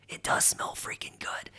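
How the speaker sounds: soft confiding whisper; breathy whispered admission; whispered aside. Real, not acted. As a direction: let them in on a guilty secret. Confessing overpriced soap smells good.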